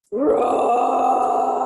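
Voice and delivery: a big playful tiger roar, loud. Acted. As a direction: roar like a wild tiger for the kids. Tiger roars in read-aloud story.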